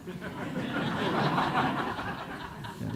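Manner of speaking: a long, high burst of laughter that starts breathy; real laugh. Real, not acted. Laughing at her question.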